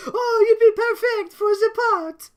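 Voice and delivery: slow, high, loud falsetto Countess; second try at the Countess voice, high and slow. Acted. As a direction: croon it like a grand diva. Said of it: "You're getting there. I'll coach you".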